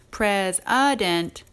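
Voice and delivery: president said slowly, middle vowel a relaxed schwa. Shown as a demonstration. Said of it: "when you get the schwa, uh, sound".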